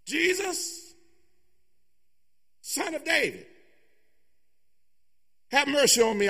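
high, pleading cry; calling out. Acted. Beggar crying out to passing Jesus, pleading. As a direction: call across the crowd for help.

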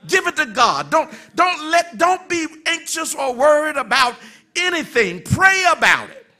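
loud, high, driving sermon voice; preacher urging the church to cast off worry; preaching at full force. Acted. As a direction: push the promise out with growing fire.

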